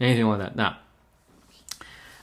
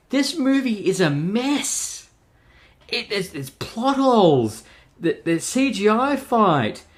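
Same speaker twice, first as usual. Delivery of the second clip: exasperated rant; 9 semitones higher than usual. Real, not acted. Reviewer venting about Sony's messy movie, exasperated high-pitched rant. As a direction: throw your hands up at the mess.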